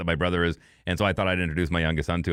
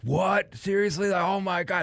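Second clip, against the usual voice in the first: high-pitched, thrilled disbelief; imitating excited fans; 11 semitones higher than usual. Fans just offered free tickets, excited disbelief. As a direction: act out fans who can't believe their luck, pitch jumping way up. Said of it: "they were just the most excited".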